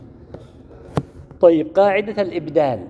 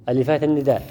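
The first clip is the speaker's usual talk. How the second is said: ordinary delivery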